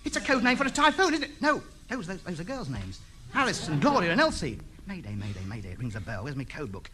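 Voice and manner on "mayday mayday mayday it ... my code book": muttering to himself. Acted. Puzzling over the call alone.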